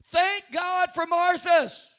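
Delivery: loud, high, stretched-out thanks; exultant preaching shout. Real, not acted. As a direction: shout it out to the congregation, slowly.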